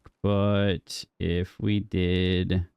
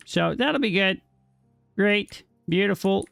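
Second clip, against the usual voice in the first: mock cheer; 10 semitones higher than usual. Real, not acted. Sarcastic cheer at slow workers.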